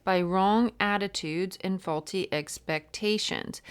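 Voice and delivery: emphatic, projected book reading; reading aloud. Real, not acted. Tarot reader reading about the Justice card, emphatic read-aloud. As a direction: deliver the passage with punched key words.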